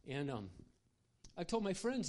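ordinary talk, nothing marked

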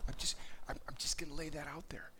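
hushed confiding whisper; whispered aside. Real, not acted. Preacher confides to congregation in a whisper. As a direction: drop to a whisper and let it out.

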